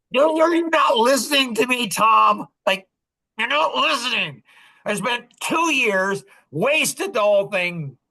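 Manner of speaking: high-pitched, aggrieved complaint; hammed-up frustration, drawing out the grievance; exasperated. Retelling how he protested to Tom about two wasted years, exasperated.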